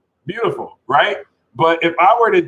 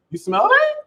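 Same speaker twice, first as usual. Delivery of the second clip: very high, sing-song coaxing; squeaky playful mock sweetness; teasing Taurus. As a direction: coax a restless partner like a child. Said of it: "Taurus can slow Leo down and be like".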